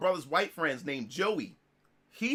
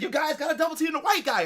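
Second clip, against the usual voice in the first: high mock complaint; playful trash talk; 8 semitones higher, faster than usual. [name] joking while gaming with friends, high mock complaint. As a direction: put on a higher teasing voice. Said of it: "we just talking trash".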